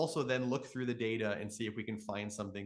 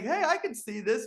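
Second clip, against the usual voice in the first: high, loud answering call; yelling back; 13 semitones higher, 7 dB louder than usual. Retold or imitated. Narrator answers the stranger across the lake, loud excited call. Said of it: "then I yell back".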